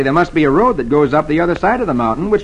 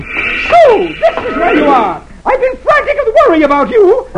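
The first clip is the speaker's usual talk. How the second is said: wife screeching at her lost husband. A narrator's reading. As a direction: shriek it as she bursts in.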